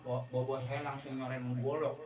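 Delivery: ordinary delivery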